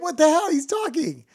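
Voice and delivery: high, loud, incredulous protest; indignant protest. Acted. Joke's dog owner protests to bartender.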